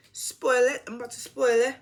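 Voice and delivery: playful Jamaican accent, spoil it. Shown as a demonstration. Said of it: "that was my attempt at doing a Jamaican accent".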